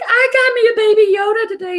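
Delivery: high-pitched, thrilled mom imitation; excited mother. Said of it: "she's all excited".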